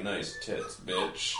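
low, menacing monster growl. Retold or imitated. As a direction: voice the killer turkey monster low. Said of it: "and he was all like".

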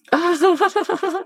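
a burst of high laughter. Real, not acted. Laughs after a quote.

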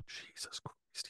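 breathless whisper, slow; hushed, slow Jesus Christ; exasperated whisper. Real, not acted. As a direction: whisper it under your breath in disbelief. Exasperated aside about Bayless.

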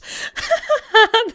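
high burst of giggling; high giggle. Real, not acted. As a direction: breaks into high laughter. Laughs at own quote.

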